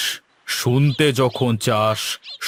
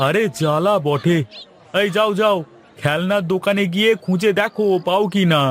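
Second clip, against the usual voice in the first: higher, exasperated brush-off; irritated shooing; 7 semitones higher than usual. Acted. Grocer shoos the servant off to the toy shop, exasperated. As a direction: wave him off, fed up.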